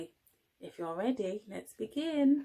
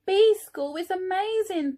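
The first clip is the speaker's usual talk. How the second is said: high, loud, thrilled exclamation; delighted thought; 10 semitones higher, 8 dB louder than usual. Acted. Norman loving bee school, high excited cry. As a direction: burst out with delight. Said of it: "thought Norman".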